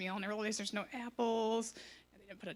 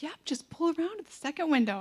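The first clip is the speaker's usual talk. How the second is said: happy worker; 6 semitones higher, 5 dB louder than usual. Retold or imitated. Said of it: "the lady's still happy".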